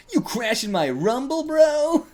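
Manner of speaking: high, put-on challenger's voice; cocky character voice. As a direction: taunt like a rumbling gang kid.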